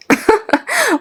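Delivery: high scornful cackle; a high-pitched acted laugh before a comeback; mocking laugh. Salma's scornful comeback to Karim. (ضحكة سخرية عالية)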